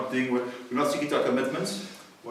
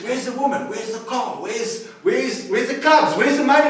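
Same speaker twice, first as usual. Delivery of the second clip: higher, building to louder; frantic, more desperate with each question; rising panic; 8 semitones higher, 4 dB louder than usual. Retold or imitated. Man in hell demanding answers from the devil, rising panic.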